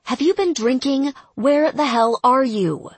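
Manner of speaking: sharp, loud demand; angry. Acted. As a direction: bark it at her. Sergeant angrily questions drunk private.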